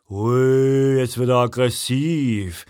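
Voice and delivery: lightly mocking, drawn-out oooh; mildly sneering big man. A narrator's reading. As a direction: taunt him with a long, teasing ooh. Big man mocks the hero.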